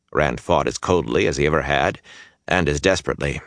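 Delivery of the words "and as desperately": ordinary delivery, nothing marked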